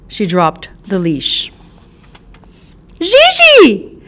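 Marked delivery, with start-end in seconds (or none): 3.0-4.0 very high, loud scream for the dog; panicked scream. Acted. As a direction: shriek the dog's name in panic. Owner screams for loose dog.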